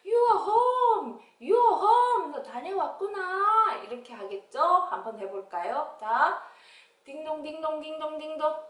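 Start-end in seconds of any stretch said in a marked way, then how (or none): 0.0-3.8 high, loud, stretched-out greeting; delighted mother's welcome. Acted. Mother greeting returning child, high delighted lilt. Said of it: "엄마가 뭐라 그래요". 7.0-8.7 high, level ding-dong chime; imitated doorbell. Child ringing at the door, imitated chime.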